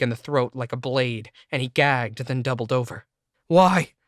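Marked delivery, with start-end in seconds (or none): 3.5-3.9 high, loud betrayed cry; shout. Acted. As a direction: yell the word at her, wounded. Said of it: "I shouted back at Cindy".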